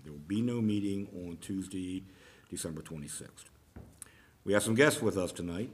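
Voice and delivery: usual speaking voice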